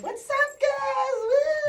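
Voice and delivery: high, loud hype greeting; over-the-top excited hello; hyped greeting. Retold or imitated. Hyped greeting to boring people.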